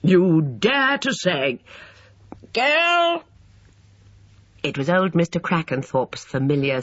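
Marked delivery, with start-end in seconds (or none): 0.0-1.6 slow, high indignant challenge; affronted, raised-pitch retort; outraged. A narrator's reading. Harold in quarrel, outraged. 2.5-3.2 very high, peremptory call; cranky old man's high holler. A narrator's reading. Old man calls to Lucy.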